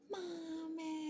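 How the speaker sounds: put-on very high, drawn-out child's wail; mock tearful child's cry; imitated crying scream. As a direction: wail for mother like a crying kid, held back in volume. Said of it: "he screamed".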